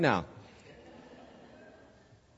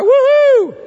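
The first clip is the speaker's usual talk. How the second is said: much higher, louder whoop; joyful whoop; 9 dB louder than usual. Retold or imitated. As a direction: cheer like you're dancing to the car. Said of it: "I danced all the way".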